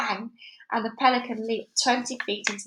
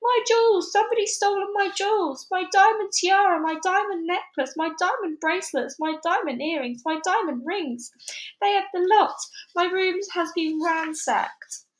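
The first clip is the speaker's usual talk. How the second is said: hysterical screaming; 9 semitones higher than usual. A narrator's reading.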